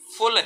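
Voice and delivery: फुल with a short u. Shown as a demonstration. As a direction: keep the vowel of फुल brief. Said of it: "मात्राओं का अंतर समझाता हूँ".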